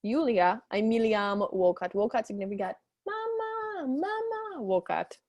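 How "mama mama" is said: put-on high child's voice calling mama; little girl calling. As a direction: call out like a small girl. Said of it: "She calls".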